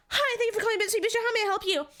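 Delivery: high, rushed head-voice greeting; stressed customer service voice. Acted. Host grabbing the last ring, high stressed phone voice. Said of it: "I was pretty stressed out".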